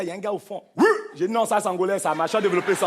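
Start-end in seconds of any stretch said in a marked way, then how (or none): 0.8-0.8 short, high mock whoop; imitated shout from the crowd. Comic retelling a man's reply from the back, mock shout. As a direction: yelp it like a heckler at the back. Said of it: "quand j'ai crié comme ça".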